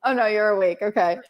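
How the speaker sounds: ordinary talk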